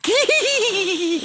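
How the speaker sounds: high squeaky doll voice. Retold or imitated. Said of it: "holding it up and going".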